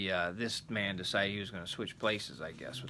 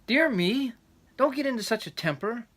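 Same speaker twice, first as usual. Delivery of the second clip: wife's voice; 12 semitones higher, 6 dB louder than usual. Acted. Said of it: "said the wife".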